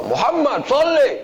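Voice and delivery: put-on sharp high shout of the boy's name.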